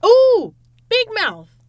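loud, high burst of remembering; sudden excited recall. Real, not acted. Friend finally remembers the cartoon's name, excited loud shout. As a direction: blurt it out, drawn out and triumphant.

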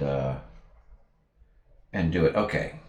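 normal talking voice

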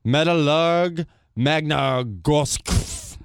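very slow, unsure Russian name; halting attempt at a Russian team name; slow careful pronunciation. Real, not acted. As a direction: sound out each syllable slowly. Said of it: "You sounded better with saying it".

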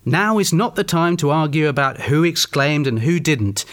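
ordinary talk, nothing marked

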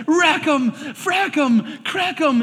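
high, loud fake-cussing tirade; mock cussing. Ralphie's tirade of fake obscenities at the bully, high and loud. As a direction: spit out the fake curses like a furious kid. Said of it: "He's like".

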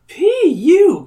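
high, loud disgusted squeal; Gabe complaining. Acted. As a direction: squeal it like a grossed-out kid. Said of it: "Gabe complains".

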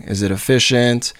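ordinary talk, nothing marked

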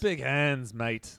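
put-on "Big hands, mate" in a weak Australian accent; a lightly done Aussie accent; bad Australian accent. Echoing the joke in a weak Australian accent. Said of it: "I got the worst accents".

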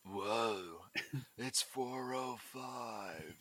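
slow, soft Seagal murmur; hushed mock Seagal, very slow; Seagal whisper. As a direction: breathe it out like Seagal. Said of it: "let's do Battling Steven Seagal's".

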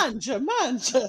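loud mock Italian sing-song; booming sing song welcome. Joke about him being Italian.

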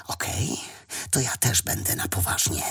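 hushed, whispery resolve; inner thought. A narrator's reading. Elio deciding to fight seriously, whispered inner thought. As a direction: say it under the breath, to yourself. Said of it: "pomyślał Elio".

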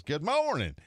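loud, high drawn-out call; Bobby's hearty greeting. Retold or imitated. Buddy calling to a stranger from the car window, hearty holler. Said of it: "Hit me with the accent".

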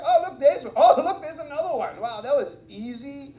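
high, loud, laughing mock wonder at easy miracles; breezy, amused miracle spotting; casual delight. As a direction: toss off each miracle like spotting coins. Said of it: "I'm like".

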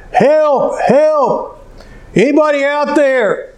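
high, drawn-out cry for help; acted yell for help. Man hanging from a branch calls for anyone above, high acted yell. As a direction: call out into empty space, pitched high. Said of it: "he started yelling".

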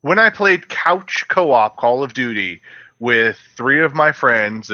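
ordinary delivery

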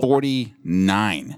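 very slow, stretched number; drawn out. Real, not acted. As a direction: drag out the number slowly. Emphasizing episode number.

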